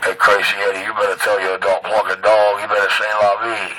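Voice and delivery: deep taunting singsong; low mocking voice. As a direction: drop low and mock him. Low mocking chant at rival.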